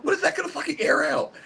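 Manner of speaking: high-pitched mock woman's voice; exaggerated high impression of an exasperated woman; imitated woman's retort. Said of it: "She went".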